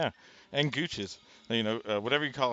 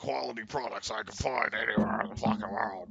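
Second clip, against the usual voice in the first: whisper. Pretend. Mock ad, Infowars parody.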